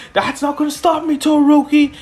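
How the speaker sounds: high voice rising to a shout; acted high-pitched challenge; defiant call. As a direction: yell your defiance at a rival. Hero defies rival mid-race.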